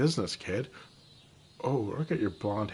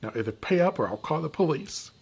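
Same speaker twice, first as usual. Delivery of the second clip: ordinary talk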